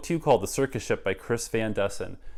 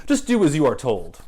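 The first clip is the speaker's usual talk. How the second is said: bossy order; 5 semitones higher, 9 dB louder than usual. Acted. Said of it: "said Mister Payne".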